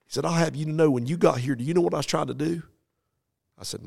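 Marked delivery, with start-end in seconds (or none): 0.2-2.6 higher, louder challenge, picking up speed; raised confrontation. Retold or imitated. Farmer challenges the preacher, loud and rising. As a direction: demand he guess.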